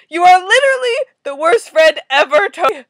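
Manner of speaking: loud, high accusation; furious, full-volume scolding; outraged shout. Real, not acted. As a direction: yell it at a terrible friend. Furious reaction to comic character.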